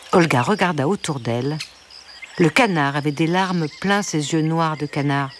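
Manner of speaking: ordinary delivery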